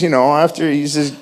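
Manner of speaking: ordinary talk, nothing marked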